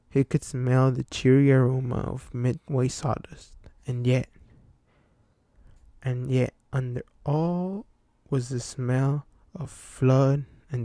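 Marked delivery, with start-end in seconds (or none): none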